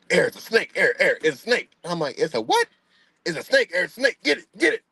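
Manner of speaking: high, frantic mock panic; imitating a scared dad, high; panicked dad. Dad panicking over snake.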